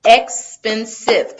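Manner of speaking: "Expensive" slowly chopped as EX, PEN, SIVE; three separate syllables. Shown as a demonstration. Teacher repeats the count, "Expensive" in three slow separate beats. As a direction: sound out "Expensive" beat by beat, with gaps. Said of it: "three syllables".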